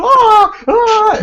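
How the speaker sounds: retold shrieks; panicked scream. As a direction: flee the wasps.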